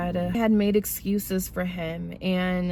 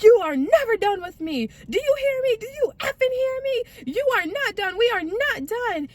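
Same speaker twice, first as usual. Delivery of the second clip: high-pitched mock-furious insistence; hammed-up angry demands; imitated going off. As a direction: act out his possessive rant way up high. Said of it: "He was going off".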